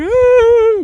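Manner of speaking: a high, loud, hammed-up sob; fake crying wail. Wife fakes sobbing for the detectives, loud high mock wail. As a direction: wail it like crocodile tears. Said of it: "very upset, crying".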